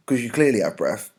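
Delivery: ordinary talk, nothing marked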